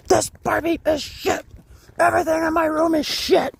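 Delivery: very high, loud screamed outburst; tantrum scream. Retold or imitated. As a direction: scream it like a sister throwing a tantrum. Sister's tantrum acted in story.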